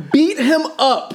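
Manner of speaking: high, slowed words through laughter; laughing. Real, not acted. Cracking up retelling an absurd movie scene, laughing in disbelief. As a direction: say it while really cracking up.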